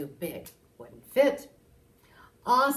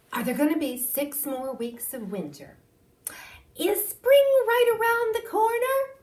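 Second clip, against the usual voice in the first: eager questioner; 12 semitones higher, 7 dB louder than usual. Acted. Townspeople's questions to Groundhog.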